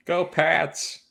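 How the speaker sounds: high-pitched Boston sports-fan cheer; Boston accent. Acted. Co-host chiming in after talk of doing Boston, Boston accent. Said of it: "I would do my Boston".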